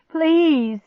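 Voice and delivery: high, loud drawn plea; desperate begging. A narrator's reading. Girl yanks dog's collar, begging desperately. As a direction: beg with all urgency. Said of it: "I begged".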